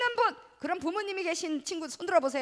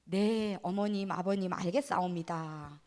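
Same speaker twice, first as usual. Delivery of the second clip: put-on overly polite child; 7 semitones lower, 4 dB quieter, slower than usual. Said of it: "우리 친구들은 뭐라고 해요?".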